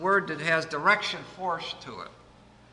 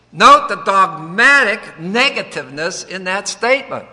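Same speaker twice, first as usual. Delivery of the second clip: rising, louder pulpit emphasis; preaching; 5 semitones higher, 9 dB louder than usual. Acted. Preacher stressing finality to the congregation, forceful. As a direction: hammer home the finality to the congregation. Preacher driving home his point.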